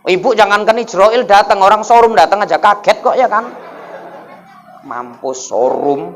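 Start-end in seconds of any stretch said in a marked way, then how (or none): 4.9-6.2 low, slow, softer groan of doom; mock panic. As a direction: mutter it like you're done for, drawn out. Panicked reaction to showroom visitor. (keluhan panik pura-pura, rendah dan lambat)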